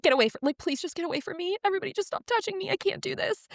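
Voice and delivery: high, rushed begging for space; retold overwhelmed plea. New mother begging everyone to stop touching her, retold overwhelm. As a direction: plead to be left alone, pitch climbing.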